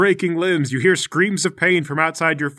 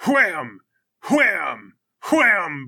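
falling, stretched impact noises; one heavy blow at a time, with pauses; imitated slams; much slower than usual. Game master voicing a head hitting stone, slamming noises.

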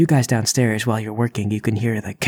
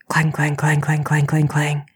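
high, chanted clang clang; clanging sound effect; 5 semitones higher than usual. Acted. GM sounding out the pounding on the toilet, rhythmic clangs. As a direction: hammer out the clangs like metal. GM makes the plunger noise.

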